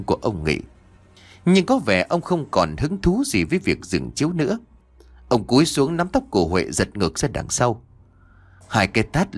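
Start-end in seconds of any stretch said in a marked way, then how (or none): none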